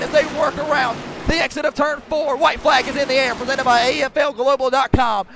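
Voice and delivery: loud, driving motorsport commentary; live race play-by-play. Real, not acted. Announcer calls the last lap for stream viewers, live race play-by-play. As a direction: call the race at full force, punching the key words.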